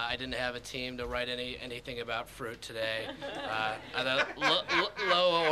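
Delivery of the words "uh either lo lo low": laughing. Real, not acted. Laughing at his own joke.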